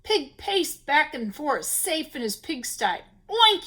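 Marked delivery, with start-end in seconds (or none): 3.3-3.6 high, loud pig oink. A narrator's reading. Pig shouts at the howling wind, oinking loud. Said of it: "he shouted at the howling wind".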